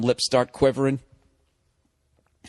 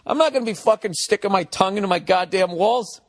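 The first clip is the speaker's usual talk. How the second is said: ordinary talk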